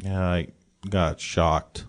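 low, slow and dazed; dazed junkie. Acted. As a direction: mumble like you just came to. Dazed junkie NPC reply.

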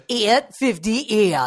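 slow, exaggerated Geordie announcer impression; Geordie accent. Guest joins in mimicking the announcer, drawn-out Geordie accent. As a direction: stretch the numbers out in mock Geordie. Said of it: "That's a Geordie accent".

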